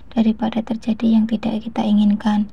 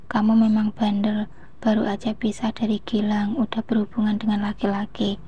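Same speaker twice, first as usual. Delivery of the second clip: ordinary delivery, nothing marked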